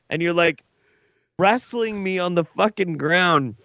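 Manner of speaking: usual speaking voice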